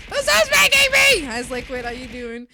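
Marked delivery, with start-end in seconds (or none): 0.1-1.3 very high, loud mock greeting; imitating mom. Mom greeting her, mocked very high and loud. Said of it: "she was like".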